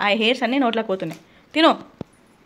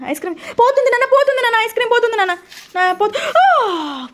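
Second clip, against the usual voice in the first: high, frantic warning cry; real panicky, high-pitched urging; urgent alarm; 9 semitones higher than usual. Real, not acted. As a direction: cry out as it starts slipping away. Ice cream dripping, urgent calling out.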